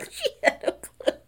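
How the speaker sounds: high, breathless laughing squeal; words squeaked through real laughter. Real, not acted. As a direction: crack up while getting the words out. Breaks up retelling Portia's cluelessness.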